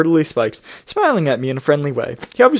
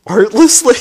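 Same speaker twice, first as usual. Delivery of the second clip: incredulous echo; 6 semitones higher, 4 dB louder than usual. Real, not acted. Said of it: "I asked, artlessly".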